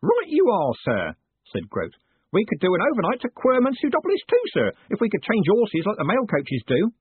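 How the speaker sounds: put-on high, eager old man voice; old postman. A narrator's reading. Old Groat chatting to his boss, in a high eager voice. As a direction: chatter like a fussy old clerk.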